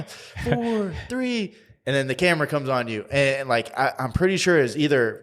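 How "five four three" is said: high voiced producer countdown. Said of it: "She's like".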